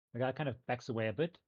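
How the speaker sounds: usual speaking voice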